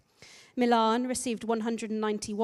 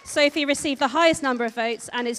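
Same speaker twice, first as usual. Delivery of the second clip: louder, proclaiming the winner; loud announcement; 3 semitones higher, 9 dB louder than usual. Real, not acted. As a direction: announce it loudly to the room. Announcing the winning candidate.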